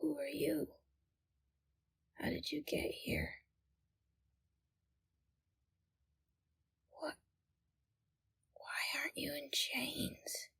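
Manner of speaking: hushed fearful whisper; frightened whisper. Acted. Captive Flora whispering to a stranger, frightened whisper. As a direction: whisper so the captors won't hear.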